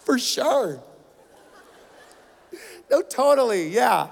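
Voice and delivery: hesitant, isolated noncommittal agreement; feigned understanding. Retold or imitated. Nodding along to a teammate he can't understand, faking comprehension. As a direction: bluff along as if you followed. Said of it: "I'd just be like this".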